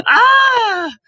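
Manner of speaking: high loud scream of pain. A narrator's reading. Quirrell screaming as Harry grabs his face. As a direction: cry out as the skin burns.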